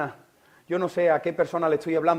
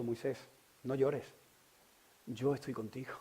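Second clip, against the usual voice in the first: quiet comfort; 4 semitones lower, 12 dB quieter than usual. Acted. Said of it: "me ha dicho".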